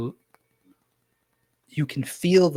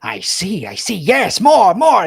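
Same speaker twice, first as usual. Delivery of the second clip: rising to a high, loud cry of more; eager old wizard; 7 semitones higher, 11 dB louder, slower than usual. Acted. Wizard urging Barbo to go on, high and loud. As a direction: wizard hungry for the story, going high. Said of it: "he goes".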